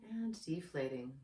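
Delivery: soft, unhurried meditation guide voice; guided meditation. Acted. Yoga teacher guides anxious viewers through breathing, in a soft meditation voice. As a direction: ease the listener into calm breathing.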